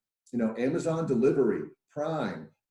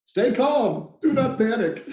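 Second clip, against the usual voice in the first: emphatic call; 10 semitones higher, 6 dB louder, slower than usual. Shown as a demonstration. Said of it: "People can smell fear".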